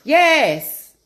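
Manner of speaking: high, loud sassy yes. Pretend. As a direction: fire back yes. Mock answering the nurse.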